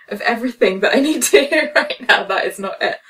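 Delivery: half-laughing, incredulous; laughing disbelief. Retold or imitated. Laughing disbelief at therapist's advice.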